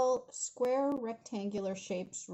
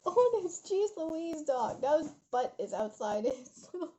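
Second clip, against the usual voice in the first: laughing in disbelief; 5 semitones higher than usual. Real, not acted. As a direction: exclaim through laughter at the dog's antics. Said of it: "I can't stop laughing".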